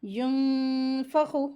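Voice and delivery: यंफखु with a long nasal hum on the n; ghunna. Shown as a demonstration. Teacher demonstrates ikhfa, long nasal hum in यंफखु. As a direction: hum the hidden n of यंफखु before fa. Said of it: "गुन्ना करके नून की आवाज़ को".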